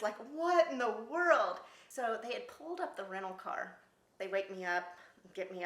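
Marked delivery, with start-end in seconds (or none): 0.3-1.6 slow, higher, louder bewildered question; dazed confusion. Retold or imitated. Waking up yanked by her feet, slow bewildered. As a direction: wake up baffled. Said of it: "I just remember this".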